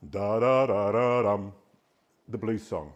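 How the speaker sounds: ordinary talk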